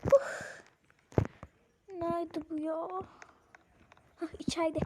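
ordinary talk

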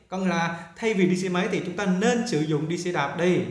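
ordinary talk, nothing marked

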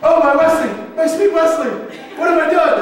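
high, loud melodramatic lament; hammed-up princess crying out for her love; distraught princess. Princess realizing she shoved her beloved, hammed-up distress. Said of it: "In which case she goes".

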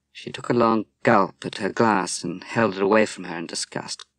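ordinary delivery, nothing marked

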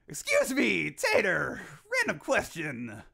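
ordinary talk

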